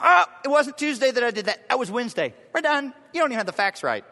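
mock indignant correction; hammed-up petty correcting; indignant nitpicking. Nitpicking listener acted out.

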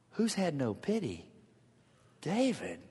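ordinary talk, nothing marked

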